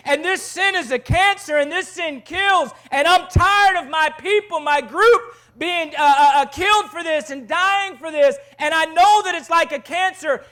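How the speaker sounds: high, loud, driving sermon delivery; full-force preaching. Real, not acted. Preacher holding up Phineas against sin, preaching at full force. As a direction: build to a fervent pulpit shout.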